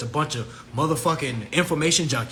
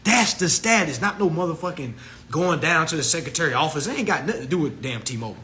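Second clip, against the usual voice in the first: ordinary talk, nothing marked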